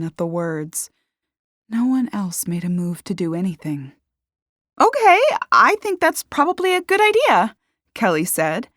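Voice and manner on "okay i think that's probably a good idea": high, loud flustered agreement; urgent agreement. A narrator's reading. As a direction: jump in to back the idea. Woman backs butler amid panic.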